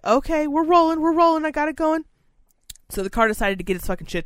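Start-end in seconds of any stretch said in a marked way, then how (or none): 0.0-2.1 relieved excitement. Retold or imitated. Car finally moves, relief.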